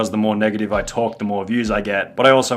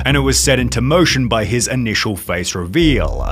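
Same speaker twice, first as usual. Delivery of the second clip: loud, slow, hyped documentary voice; dramatic narration; 3 semitones higher, 9 dB louder than usual. Acted. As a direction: narrate it like a doom-laden exposé. Dramatic commentary narration parody.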